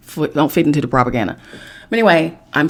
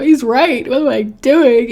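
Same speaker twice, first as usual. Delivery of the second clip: higher, louder self-doubt; suddenly second-guessing herself; dismayed; 8 semitones higher, 5 dB louder, slower than usual. Retold or imitated. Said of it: "and I was like".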